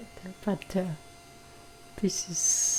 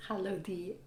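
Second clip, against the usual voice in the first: ordinary delivery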